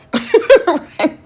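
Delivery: loud high laugh; burst of laughter. Real, not acted. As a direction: laugh at yourself. Laughs at own word.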